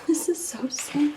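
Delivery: soft sad whisper; whispered sadness. Real, not acted. Owner watches her stitched-up cat, sad whisper. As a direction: breathe it out in a hush.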